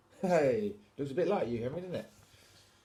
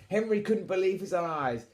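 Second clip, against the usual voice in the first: ordinary delivery, nothing marked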